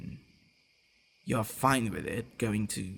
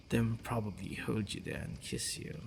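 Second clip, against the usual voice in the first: ordinary talk, nothing marked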